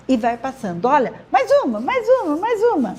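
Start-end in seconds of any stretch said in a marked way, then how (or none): 1.3-2.9 higher-pitched, sing-song prompts in a quick game; playful urging. Acted. Teacher playing a timed word game with a child, playful urging. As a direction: egg the reader on playfully, pitch jumping up each time.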